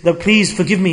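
ordinary talk, nothing marked

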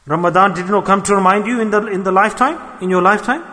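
preacher pleading with his brothers about lost Ramadan, loud and urgent; full-force preaching. Acted. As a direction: drive the warning home to the congregation. Preaching climax on Ramadan passed.

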